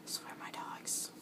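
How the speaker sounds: breathy exasperated whisper. Real, not acted. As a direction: whisper it under your breath. Exasperated aside about dogs.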